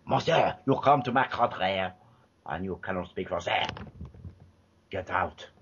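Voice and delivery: lightly put-on French accent, dismissive; a hint of a French accent telling him off; mild mock French-accented brush-off; mock French cop. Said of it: "in a bad English fucking voice".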